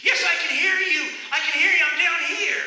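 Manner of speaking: high, loud, frantic shout upward; desperate yell. Acted. Jack hanging from a branch answers God, frantic yell. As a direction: yell back up the cliff, half laughing.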